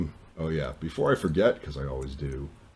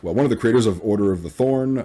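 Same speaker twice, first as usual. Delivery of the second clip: usual speaking voice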